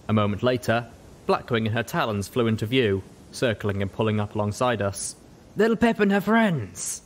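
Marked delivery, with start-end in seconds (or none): 5.6-7.0 high, slow, wry greeting; Blackwing's greeting. Acted. Griffin merc Blackwing greets Little Pip, slow and wry. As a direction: tease the little pony as you arrive. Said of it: "she said".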